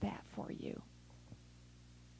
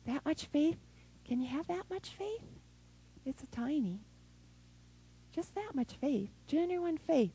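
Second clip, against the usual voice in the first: soft, higher, intimate question; hushed; 5 semitones higher, 8 dB quieter than usual. Real, not acted. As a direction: draw them in softly. Intimate question on tiny faith.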